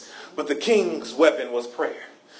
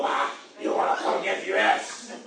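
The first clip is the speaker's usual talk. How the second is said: much lower, whispery mock threat; Trump impression; 10 semitones lower than usual. Preacher imitating Trump's threat, much lower whispery mock. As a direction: growl it like a mocking tough guy. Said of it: "He gonna".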